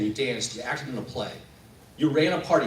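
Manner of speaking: ordinary talk, nothing marked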